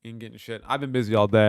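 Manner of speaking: normal talking voice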